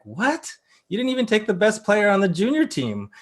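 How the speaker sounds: high, incredulous imitation of fans; mock disbelief. Analyst voicing everyone's reaction to the draft, mock disbelief. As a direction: act out a stunned, high-pitched reaction.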